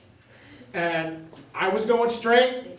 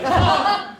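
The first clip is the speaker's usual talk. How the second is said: startled pop-up; 11 semitones higher, 6 dB louder than usual. Retold or imitated. Popping up after being hit.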